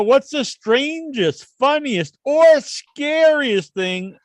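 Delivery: drawn out. Real, not acted. Reading a chat question dramatically.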